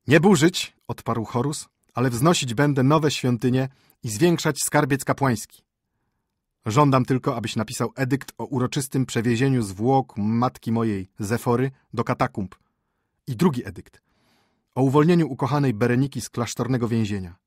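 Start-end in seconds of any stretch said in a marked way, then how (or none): 0.1-0.7 high, loud royal refusal; emphatic command. A narrator's reading. Heir answering the high priest, emphatic command. Ruler rejects tearing down the temple. 1.9-17.3 higher, louder voice of royal decree; commanding young ruler. A narrator's reading. Ruler dictating reforms to his chancellor, commanding.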